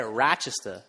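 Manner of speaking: "Rochester" said the local way, with the final r dropped, like "Rochesta"; local Rochester pronunciation. Shown as a demonstration. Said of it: "that's how they say it".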